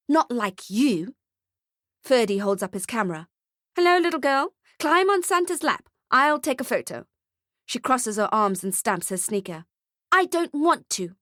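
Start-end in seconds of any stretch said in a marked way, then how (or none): 0.1-1.2 put-on slow, sneering child voice; a spoiled brat's put-down; bratty girl voice. A narrator's reading. 3.8-7.1 much higher, sing-song salesman; syrupy photographer. A narrator's reading. Photographer's sweet voice. 10.1-11.2 put-on higher, sulky child; bratty girl voice. A narrator's reading.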